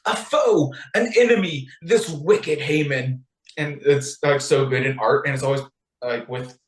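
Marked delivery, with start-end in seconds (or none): 0.1-3.2 high start, slowing onto the name; dramatic accusation. Retold or imitated. Queen denouncing the villain to the king, theatrical accusation. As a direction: declaim it like a queen unmasking the villain.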